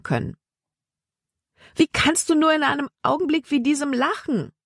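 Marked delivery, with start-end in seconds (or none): 1.7-4.5 indignant. A narrator's reading. Libby offended at his laughing.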